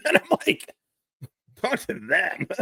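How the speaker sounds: high-pitched, laugh-choked retelling; cracking up mid-sentence. Real, not acted. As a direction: squeeze the words out through laughter. Cracking up while retelling the story.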